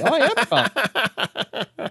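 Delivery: high, slow, mock-innocent insistence; drawn-out sarcastic claim of calm; sarcastic protest. Told by his wife to calm down, he answers with mock-innocent sarcasm.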